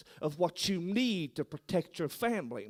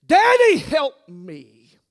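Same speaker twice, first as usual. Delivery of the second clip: high, loud cry of Daddy, falling on help me; a frightened child crying out for Daddy; child calling for help; 8 semitones higher, 16 dB louder, much slower than usual. Acted.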